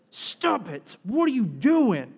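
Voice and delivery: acted indignant scolding, rising in pitch; teacher rebuking his followers, mock indignant. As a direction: scold them in disbelief at what they did. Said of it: "He's indignant".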